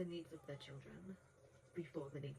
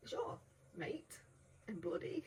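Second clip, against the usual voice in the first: British slang; 5 semitones higher, slower than usual. Retold or imitated. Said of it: "words that British people would use".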